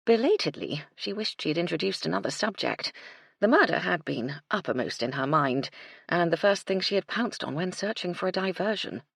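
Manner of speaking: plain reading voice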